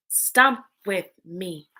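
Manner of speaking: ordinary talk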